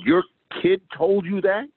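high, disbelieving question; incredulous. Retold or imitated. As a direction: act stunned he said that to his dad. Incredulous reply to friend.

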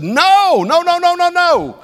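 high, loud alarmed father's yell; panicked father yelling no at his child; screamed no. Retold or imitated. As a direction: shout no to stop the child. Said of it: "kinda screamed".

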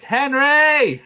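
high, loud call down the hall. Retold or imitated. Brother calling down the hallway, a loud high call. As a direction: yell his name from the other room.

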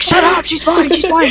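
mild mock stepdad. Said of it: "my stepdad was like".

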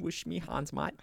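put-on joky British accent; mock British accent. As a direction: play a quiet British bloke. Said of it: "got that British accent".

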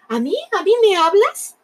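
high, startled question; who, me? genuinely surprised; Love can't believe someone calls him. A narrator's reading. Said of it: "el amor dijo".

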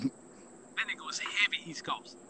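whispered tease, slow and soft. Real, not acted. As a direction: whisper it slowly like a joke. Teasing remark about a friend.

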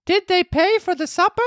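put-on high puppet boy voice; Pinocchio voice. Reads Pinocchio's line.

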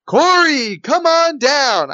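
high, drawn-out contestant call; game show announcer. Retold or imitated. Game show announcer calls a contestant from the audience, high drawn-out call. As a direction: sing out the name like a show announcer. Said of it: "they make it sound like it's a lottery".

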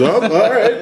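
high, loud mock taken-aback reply; acted-out startled agreement; retold startled reaction. Retold interviewer reacting to shocking answer.

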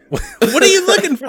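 high mocking voice of a lost kid; mock confusion. Father mimicking his son lost while looking for the plug. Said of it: "they go".

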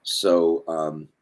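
ordinary delivery

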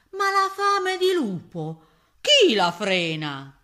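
loud boast, leaping high then dropping; ravenous wolf. A narrator's reading. Full-bellied wolf still hungry, crying out. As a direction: cry it out with greedy relish.

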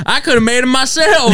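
high, gruff uncle voice. Retold or imitated. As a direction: scoff like a know-it-all uncle. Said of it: "he's like".

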